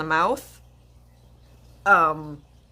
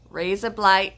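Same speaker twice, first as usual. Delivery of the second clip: ordinary delivery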